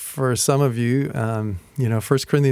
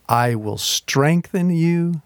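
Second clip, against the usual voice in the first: slow emphasis; 5 semitones higher, much slower than usual. Acted. Scripture quoted slowly.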